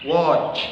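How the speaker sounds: "Watch" said clearly as woch, falling; model word watch. Shown as a demonstration. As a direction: model "Watch" cleanly for students. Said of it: "seperti pada kata watch". (contoh pelafalan "Watch")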